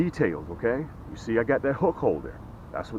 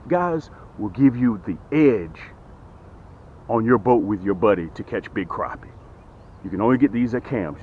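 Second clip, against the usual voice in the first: sales pitch; 3 dB louder than usual. Acted.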